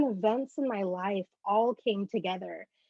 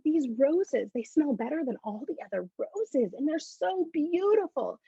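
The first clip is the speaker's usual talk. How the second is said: put-on higher, gushing child voice; bubbly little kid; 6 semitones higher than usual. Bubbly kid telling granddad about the roses, gushing. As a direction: marvel like an excited little kid. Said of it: "I was very bubbly little kid".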